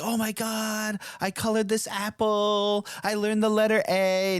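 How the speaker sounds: put-on high, eager kid voice; chirpy pre-K kid; excited little kid. Parent imitating a pre-K kid showing off, high and excited. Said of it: "they're like".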